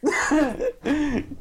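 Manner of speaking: a high burst of mocking laughter; imitating a sudden scornful laugh; imitated laugh. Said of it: "Y el weón así como".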